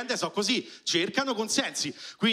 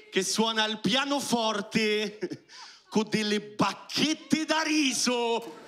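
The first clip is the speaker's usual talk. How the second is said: usual speaking voice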